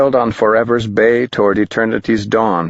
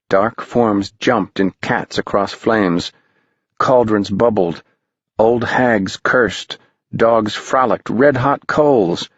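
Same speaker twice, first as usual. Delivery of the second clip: ordinary delivery, nothing marked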